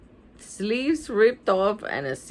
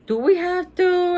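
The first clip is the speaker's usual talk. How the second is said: put-on much higher, louder kid's complaint; reluctant protest; 10 semitones higher, 5 dB louder than usual. Stink to Mom about boring shopping, reluctant protest. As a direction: push it high in protest.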